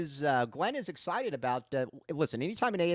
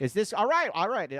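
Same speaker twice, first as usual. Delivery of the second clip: a bit higher and louder, pushing the voice; talking louder; 7 semitones higher, 8 dB louder than usual. Real, not acted. Host raising his voice for his co-host over a weak mic, louder. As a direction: project over a bad mic. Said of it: "You're gonna have to talk loud".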